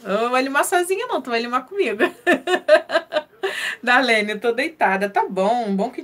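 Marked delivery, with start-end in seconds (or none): 2.1-3.8 laugh. Real, not acted. Laughs again.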